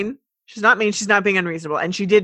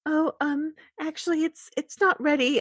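timid pony voice; 7 semitones higher, 4 dB quieter than usual. Acted. Voicing Fluttershy hesitant.

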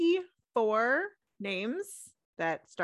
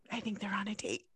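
whisper; 6 dB quieter, faster than usual. Acted. Gasp then whispered gossip.

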